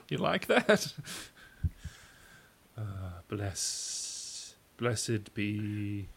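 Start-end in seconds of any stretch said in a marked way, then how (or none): 0.0-0.9 very high, loud mock taunt; taunting the audience. Host imitating a comic goading the audience, very high and loud. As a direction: squeak it out to the crowd. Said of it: "And just be like".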